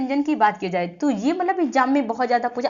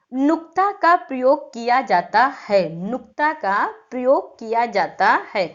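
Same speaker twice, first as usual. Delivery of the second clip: slow, deliberate dictation; unhurried teacher dictation, evenly spaced; dictating. Shown as a demonstration. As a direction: spell out the sentence word by word for copying. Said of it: "लिख लीजिए definition में".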